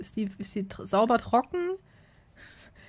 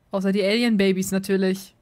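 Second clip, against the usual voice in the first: ordinary talk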